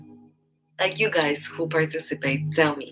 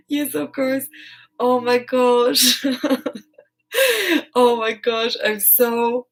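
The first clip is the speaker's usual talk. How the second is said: high, loud flustered gushing; embarrassed happy excitement; blushing delight; 7 semitones higher, 6 dB louder than usual. Real, not acted. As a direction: gush while flustered and laughing. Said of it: "now I think I'm blushing".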